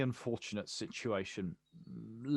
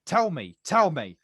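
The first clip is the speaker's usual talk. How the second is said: louder and higher, insisting hard; demanding shout; 5 semitones higher, 9 dB louder than usual. Acted. Poet confronting Harper over her hidden past, angry demand. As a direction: press her for the truth, voice rising.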